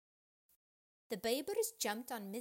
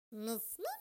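quacker voice. A narrator's reading. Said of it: "a quacker began".

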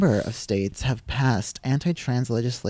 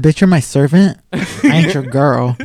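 loud, sassy diva comeback; 8 dB louder than usual. Retold or imitated. A friend acts out a loud comeback to the order taker. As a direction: fire back at her like she's the help. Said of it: "He said".

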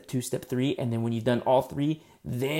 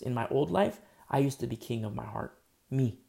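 normal talking voice

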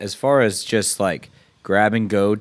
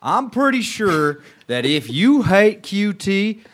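put-on Southern accent, proud and brash; 6 semitones higher, 4 dB louder than usual. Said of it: "good Southern accent".

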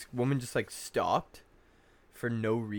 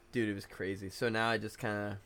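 usual speaking voice

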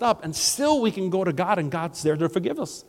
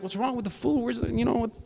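higher, impatient mock complaint; a guest fed up with waiting for dinner, higher and impatient; 6 semitones higher, 5 dB quieter, faster than usual. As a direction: grumble about the food at a higher pitch. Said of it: "and I was being impatient".

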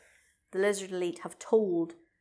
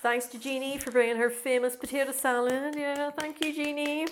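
community meeting host; 6 semitones higher than usual. Pretend. Mock meeting announcement.

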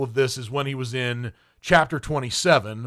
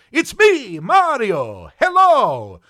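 loud, high-pitched cartoon Italian game hero; Mario; 8 semitones higher, 6 dB louder, slower than usual. Critic mimicking a game voice to mock an actor's accent, cartoonish impersonation. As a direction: belt it out high, like a video-game plumber's greeting. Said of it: "best, uh, Mario, uh, impersonation".

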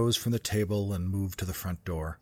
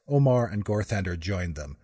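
usual speaking voice

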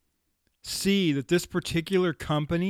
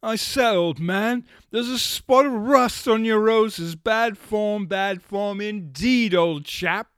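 hammed-up upper-class British accent, higher and louder; mock posh British accent; 5 semitones higher, 5 dB louder than usual. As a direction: tut like a stuffy English gentleman, stretching bad form indeed. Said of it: "Or even the Brits".

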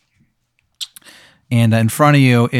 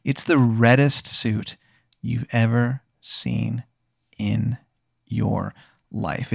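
drawn-out description; 5 dB quieter, much slower than usual. A narrator's reading. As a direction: draw out each word of the description. Game master dwells on a red suit.

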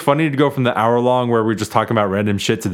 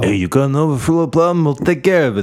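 put-on New York accent. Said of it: "New York boys to Russian accents".

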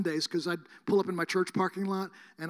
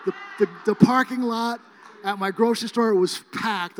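excited; 4 semitones higher, 10 dB louder than usual. Retold or imitated. Excited about the packed lot.

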